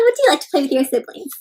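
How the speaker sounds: ordinary delivery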